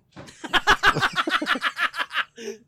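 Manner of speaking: shrieking laughter; high laugh. Real, not acted. Big laugh.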